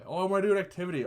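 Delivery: high, fast mocking imitation; mock eager quote. Mocking someone wanting an activity.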